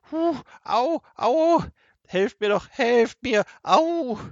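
very high, loud howls and pleas; hammed-up agony, crying out for help; wailing in pain. A narrator's reading. Seppel fakes terrible pains, wailing for help. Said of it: "wimmerte weiter".

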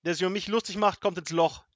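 loud, high, outraged tirade; furious officer shouting threats; fit of rage. A narrator's reading. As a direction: rant at full volume, offended authority. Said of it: "bekam einen Wutanfall".

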